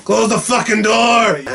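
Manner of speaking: high, loud, slow yell; shouted quote. Retold or imitated. Podcaster quoting the movie's door line, a loud yell. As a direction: bellow it like slamming out the cold.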